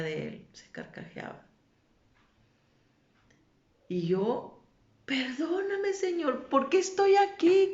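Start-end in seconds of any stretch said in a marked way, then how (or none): 5.1-7.7 high-pitched pleading, louder toward the end; imitated desperate cry. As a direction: beg the Lord in a high retold cry. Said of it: "gritaba con una desesperación". (súplica aguda y desesperada)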